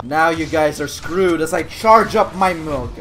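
ordinary delivery

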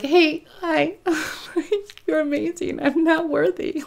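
high starstruck fangirl voice. Retold or imitated. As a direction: fawn over them in a squeaky voice. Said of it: "I'm just like".

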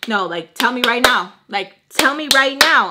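loud, building in pitch; insistent demand. Retold or imitated. Roommate demanding an answer right now, loud and insistent. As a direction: push harder on the repeat, voice rising.

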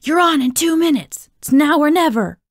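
high, strained, urgent stage call; hush-shout a warning from the doorway. A narrator's reading. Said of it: "hush shouting from the door".

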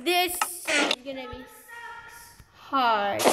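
ordinary delivery

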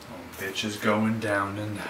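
muttered threat. Acted. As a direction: grumble it under your breath.

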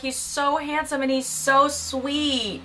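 mock gushing. Outsiders praising the husband they see, mock gushing. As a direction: imitate a smitten admirer, swooning. Imitating admirers gushing about him.

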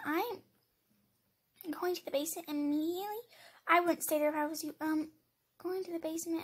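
usual speaking voice

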